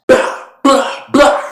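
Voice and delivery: loud, high, punched bursts; mock gunshots. Recapper acting out Catherine's gun firing, mock gunshots. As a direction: voice the gun going off as loud blasts.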